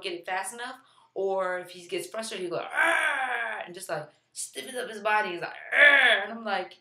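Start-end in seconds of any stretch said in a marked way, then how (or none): 2.6-3.6 put-on frustrated baby growl, higher pitch; imitated baby growl. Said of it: "just his growling". 5.7-6.2 loud, higher mock growl; imitated baby growl. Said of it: "just his growling".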